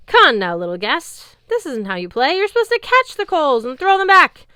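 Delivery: taunting giant. Acted. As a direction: tease like a drunk giant toying with prey. Drunken giant taunting the narrator.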